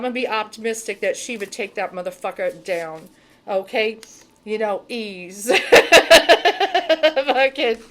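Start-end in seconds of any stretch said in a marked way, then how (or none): 5.6-6.9 loud laugh. Real, not acted. Laughs loudly at herself.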